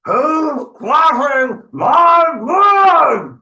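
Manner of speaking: high, drawn-out troll shout; menacing troll roar. Acted. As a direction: roar it slowly, stretching every word. Troll challenges Gruff on the bridge.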